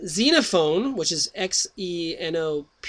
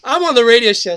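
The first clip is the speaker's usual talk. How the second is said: much louder, pulling himself back on track; loud self-correction; 5 semitones higher, 12 dB louder than usual. Real, not acted. The host catching himself after booger talk, loud reset. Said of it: "so I can't be talking like that".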